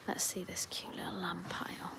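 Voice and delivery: soft hush near sleeping lambs; tender whisper. Real, not acted. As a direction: whisper so as not to wake them.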